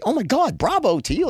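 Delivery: high, gushing mock female editor. Editor cheering on the author's crazy writing, high gushing. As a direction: squeal the praise like a delighted editor. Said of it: "and she'd say".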